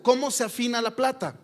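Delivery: ringing pulpit question; loud question. Acted. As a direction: throw the question at the congregation. Preacher repeats the verse question.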